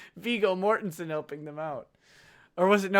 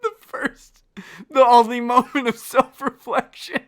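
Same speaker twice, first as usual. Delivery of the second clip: high, loud, laughing through the words; real, squeaky laughter; helpless laughter; 4 semitones higher, 4 dB louder than usual. Real, not acted. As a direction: struggle to speak while cracking up.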